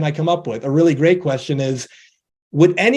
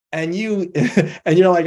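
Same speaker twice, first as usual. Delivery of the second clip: ordinary talk, nothing marked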